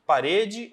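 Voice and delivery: "parede" with RE as the strong syllable; stress on middle syllable. Shown as a demonstration. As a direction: say "parede" as pa-RE-de, deliberately. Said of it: "sílaba que eu leio de maneira mais forte".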